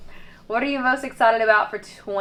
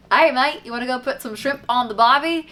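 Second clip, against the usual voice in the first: higher, louder mock Australian accent; terrible Australian accent; 6 semitones higher, 5 dB louder than usual. Teasing her Australian husband in a terrible Australian accent. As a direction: put on a badly faked Australian accent. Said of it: "in my terrible Australian accent".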